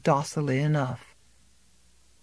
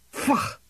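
disgusted snort; 8 semitones higher than usual. A narrator's reading. Farmer spits disgust.

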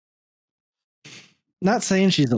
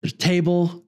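usual speaking voice

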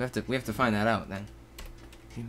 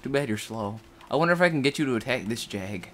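usual speaking voice

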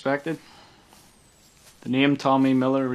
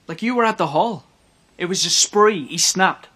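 raised, louder and higher, pressing the point; agitated insistence; 5 semitones higher, 5 dB louder than usual. Acted. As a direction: argue it out in rising frustration. Detective agitated over puzzling killings.

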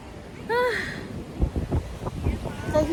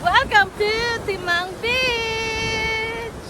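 drawn-out, higher calling welcome; excited announcement; 4 semitones higher, slower than usual. Real, not acted. Rider welcomes viewers to the beach, excitedly calling. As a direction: call it out slowly, stretching the words.

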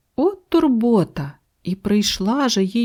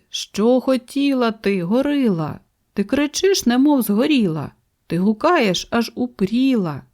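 plain reading voice

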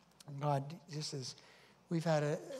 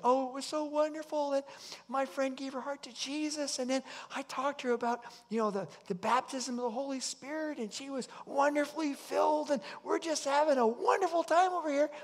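much higher and louder, gushing with joy; overjoyed; 9 semitones higher, 5 dB louder than usual. Retold or imitated. Woman telling the pastor the good news, high and joyful. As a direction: bubble over with happy news. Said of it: "she's like".

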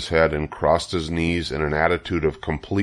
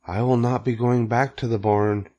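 ordinary delivery

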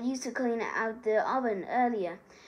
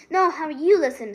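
firm retort; 5 semitones higher, 10 dB louder than usual. A narrator's reading. Hermione snapping back at Harry.

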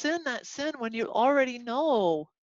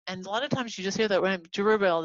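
ordinary talk

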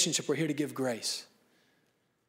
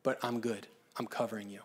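reassuring; 7 semitones lower, 7 dB quieter than usual. Shown as a demonstration. Reassuring a panicking child.

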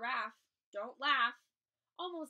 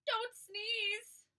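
pleading cry; 9 semitones higher, 4 dB louder than usual. Acted. Buffalo begging elephant.

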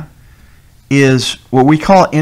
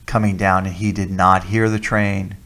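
ordinary talk, nothing marked

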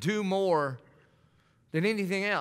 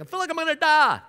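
mock dying complaint; 10 semitones higher, 4 dB louder than usual. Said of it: "God says".

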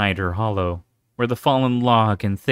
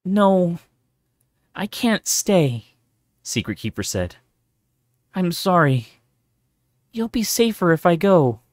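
higher, pained apologetic mother; sorrowful mother; 8 semitones higher, 3 dB louder than usual. A narrator's reading. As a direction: apologize while leaving her child.

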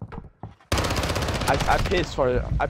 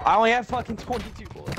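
frustrated shout; 8 semitones higher, 5 dB louder than usual. Real, not acted. Frustrated shout about ammo.

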